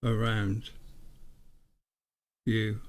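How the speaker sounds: each word spoken alone after a long pause; solemn reading, one word at a time, as if receiving it; measured, deliberate dictation with long gaps between words; word-by-word channelled message. Acted. Reads pendulum message word by word.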